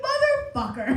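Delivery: squealed comic indignation; mock outrage. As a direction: curse him in playful disbelief. Acted reaction to cheeky reply.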